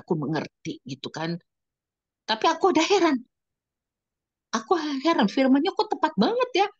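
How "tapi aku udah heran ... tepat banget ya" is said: high, marvelling; amazed. Real, not acted. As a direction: sound struck by how fitting it is. Amazed at fitting verse. (heran dan takjub dengan nada tinggi)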